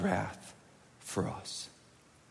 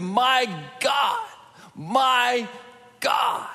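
higher, louder anguished cry of My God; retold scream; 12 semitones higher, 5 dB louder, much slower than usual. Preacher voicing Jesus on the cross, crying out in anguish. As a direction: cry it out, then let the last God fade to breath. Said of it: "Jesus screamed".

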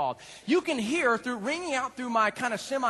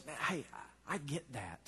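softer, understanding voice; Jesus assuring them he understands; tender; 7 semitones lower, 7 dB quieter than usual. Acted. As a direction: speak it gently, as Jesus. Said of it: "Jesus says".

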